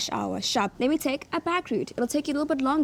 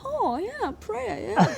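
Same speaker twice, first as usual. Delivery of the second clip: "prayer" said high as pray-er in a hammed-up British accent; a playful British "oh yeah, prayer"; mock British accent; 9 semitones higher, slower than usual. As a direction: imitate the English crowd lightly, pitched up. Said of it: "everyone was, like".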